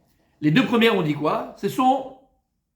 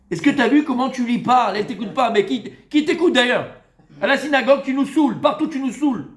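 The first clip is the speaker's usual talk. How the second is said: high, raised exasperated rebuke; aggressive scolding; 5 semitones higher, 3 dB louder than usual. Acted. Rabbi rebuking a husband for his manner, aggressively. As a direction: scold him in a high, pressing voice. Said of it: "Agressivité".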